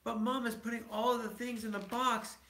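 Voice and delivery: put-on a child complaining to Papa; young cub. A narrator's reading.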